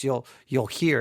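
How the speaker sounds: ordinary delivery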